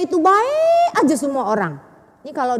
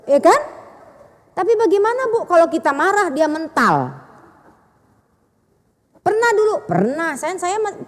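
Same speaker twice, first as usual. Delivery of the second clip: ordinary delivery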